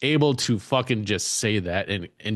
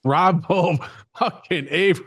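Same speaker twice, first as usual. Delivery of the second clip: laughing exclaim; 8 semitones higher, slower than usual. Real, not acted. Co-host reacts laughing.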